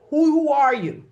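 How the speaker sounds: loud, high challenge; booming pulpit question; preacher's call. Acted. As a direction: fling the question at the congregation.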